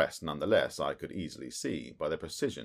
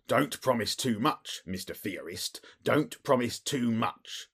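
slow, louder scolding; snapped warning; 7 semitones higher, 5 dB louder, slower than usual. A narrator's reading. Jones rebukes Holmes, slow snapped warning. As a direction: bite off each word. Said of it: "snapped the detective".

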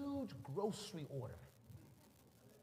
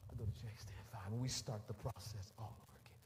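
low, hushed, defeated telling; soft and low, worn out; weary resignation; 9 semitones lower, 8 dB quieter than usual. Retold or imitated. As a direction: sink into a quiet, beaten-down voice. Weary return to the checkout.

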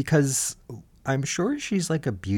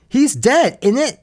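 high, louder British "innit"; British accent; 14 semitones higher, 9 dB louder than usual. Retold or imitated. Reality-show friend reacting to the explosion: high, loud British accent. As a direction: play Maura's British shock. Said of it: "my pal Maura that says".

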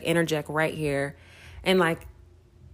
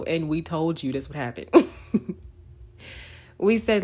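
usual speaking voice

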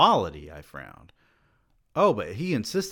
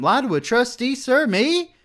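rising very high, louder with each word; shocked disbelief; 14 semitones higher, 6 dB louder than usual. A narrator's reading. Student aghast at being told to lie, rising disbelief. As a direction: climb higher in astonishment.

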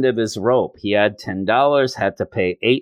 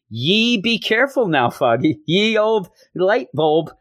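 put-on high-pitched character voice for a scolding girlfriend; voiced Glory; 8 semitones higher than usual. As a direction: pitch it way up, quaint and cautioning. Voicing Glory's quaint "yees.

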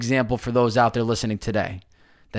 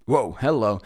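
talking through a burp; burping the words; 2 semitones lower than usual. Real, not acted. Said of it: "Excuse me, a little burp action".

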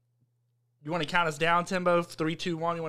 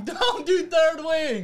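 higher, slow, laughing through it; 9 semitones higher, 4 dB louder, much slower than usual. Real, not acted. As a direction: stretch it out while cracking up. Repeats line, now laughing.

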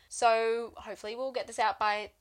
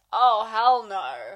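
emphatic; 10 dB louder, much slower than usual. Retold or imitated. Coco voiced, emphatic.

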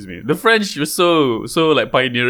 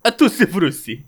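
playful fake Italian; jokey pseudo-Italian aside; mock Italian accent; 4 semitones higher than usual. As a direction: toss it off in cartoon Italian.

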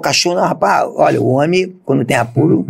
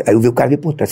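ordinary talk, nothing marked

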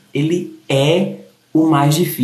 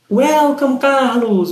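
boas-vindas animadas; 8 semitones higher, much slower than usual. Real, not acted.

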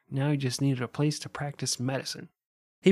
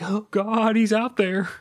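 higher hushed-alarm voice; mock scared; 7 semitones higher, 4 dB louder, slower than usual. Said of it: "I'm like".

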